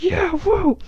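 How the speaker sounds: high, loud woo of delight; whooping cheer. Acted. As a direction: cheer like a fan at a fight. Warrior god cheers for battle.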